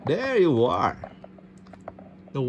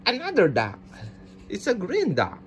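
ordinary talk

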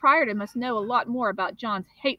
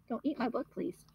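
hushed aside; 2 semitones higher, 9 dB quieter than usual. Real, not acted. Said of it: "aside to someone eating book".

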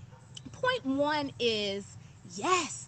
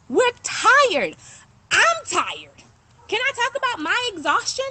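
louder, higher, passionate complaint; fired-up outburst; 7 semitones higher, 6 dB louder than usual. Real, not acted. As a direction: let the tiredness burst out loud. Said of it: "I be trying not to talk like this ... aggressive".

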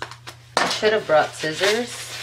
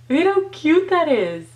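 gushing; 8 semitones higher, 3 dB louder than usual. Real, not acted. Gushes over glitter.

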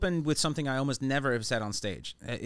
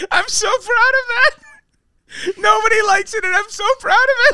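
very high, loud squeal of pride; gleeful outburst; 12 dB louder than usual. Real, not acted. Joke writer proud of his dumb joke, real gleeful squeal.

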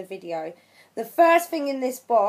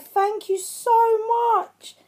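high, slow, gushing thanks; overexcited gratitude; 10 semitones higher, slower than usual. Real, not acted. Vlogger thanking her friend for beautiful paper, overexcited. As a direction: gush it, stretching every word. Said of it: "I need to calm down".

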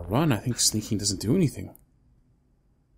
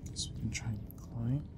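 hushed whisper while sneaking; 10 dB quieter than usual. Real, not acted. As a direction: breathe the words so nothing hears. Sneaking in a horror game.

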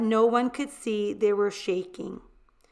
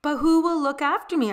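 higher, louder, quick frightened plea; pleading cry; 5 semitones higher, 5 dB louder, faster than usual. A narrator's reading. Girl begs her mother, higher, louder and fast. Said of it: "I cried".

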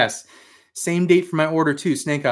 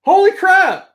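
much higher, louder excited exclamation; 13 semitones higher, 6 dB louder, slower than usual. Real, not acted. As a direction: genuine thrilled outburst. Reading early shipping email.

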